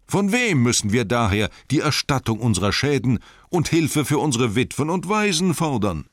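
on tiptoe, proclaim it to all; loud and clear. Acted. Master turns the crowd's blame on others, loud and clear. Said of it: "wurde sehr laut und deutlich".

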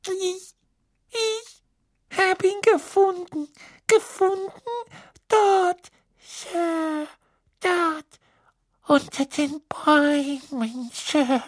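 very high, squeaky, timid elf; timid house-elf. A narrator's reading. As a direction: squeak it out fearfully, slowly. House-elf Winky speaks.

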